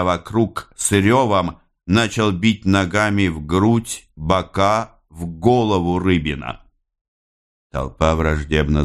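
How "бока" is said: ordinary delivery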